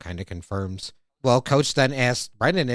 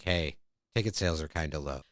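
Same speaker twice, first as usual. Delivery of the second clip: lower, much softer, quick aside; 8 semitones lower, 7 dB quieter than usual. Retold or imitated. Principal quietly confiding to the coach, hushed and hurried. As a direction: slip it in confidentially under the breath. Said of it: "kind of on the down low is like".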